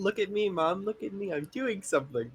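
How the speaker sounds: put-on excited kid voice. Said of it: "are you proud of me".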